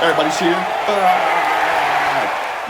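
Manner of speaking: long, loud, high cheering yell; host whipping up a big cheer; hyped cheer. Real, not acted. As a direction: lead the crowd in a drawn-out cheer.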